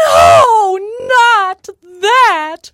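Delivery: very high, loud mock-horrified shriek. Molly pretends to dread the sack, piercing shriek. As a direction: shriek it out in feigned horror. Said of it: "shrieked Molly".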